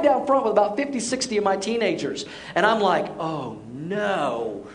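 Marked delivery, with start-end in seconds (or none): 3.2-4.7 low, sinking mock dismay; soft, low "uh-oh" dread. Youth pastor realizing the water is cold, dismayed.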